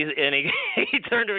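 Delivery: ordinary delivery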